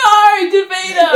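loud, high, drawn-out protest; loud dismay. Real, not acted. Player reacting to a friend's bad roll, loud drawn-out dismay. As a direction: wail it out in playful dismay.

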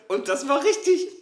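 high giggly laugh. Retold or imitated. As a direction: giggle it out happily. Mimics excited intro laugh.

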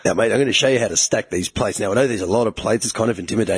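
low, laid-back Aussie gym bloke. Pretend. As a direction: talk him through it like a bogan trainer. Imitating gym bro demo.

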